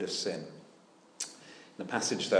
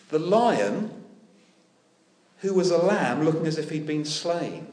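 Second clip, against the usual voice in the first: louder, higher, building proclamation; fervent preaching; 8 semitones higher, 11 dB louder than usual. Acted. Preacher exalts the lion over sin, fervent preaching. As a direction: build it up with fervour.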